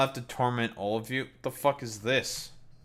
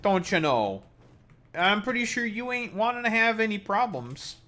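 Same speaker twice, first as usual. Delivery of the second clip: much higher hammed-up Canadian accent, mock threatening; mock Canadian threat; 10 semitones higher than usual. Streamer warns chat, mock Canadian accent threat. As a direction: put on the Canadian voice and warn them.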